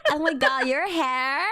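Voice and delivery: put-on Valley Girl. Valley girl admires friend's hair, high gushing accent. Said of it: "Can you do a Valley Girl accent?".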